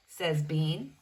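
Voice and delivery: ordinary delivery, nothing marked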